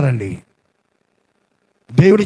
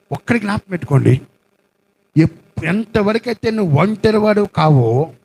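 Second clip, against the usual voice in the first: ordinary talk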